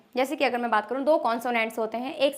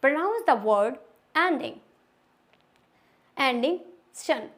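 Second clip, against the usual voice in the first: slow rule reading; much slower than usual. Shown as a demonstration. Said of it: "rule number eight".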